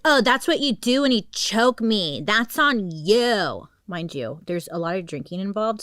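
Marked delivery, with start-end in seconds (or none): 0.0-3.7 higher, louder mocking taunt. Drunk girlfriend taunting the trapped man, loud and slow. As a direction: sneer it at him, dragging out the end. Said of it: "She's like".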